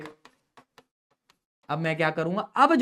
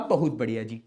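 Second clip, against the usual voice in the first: lower, sing-song mock praise; 8 semitones lower, 3 dB quieter than usual. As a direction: pat the student on the back, teasingly. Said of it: "मैंने कहा". (नकली तारीफ़, नीचे सुर में)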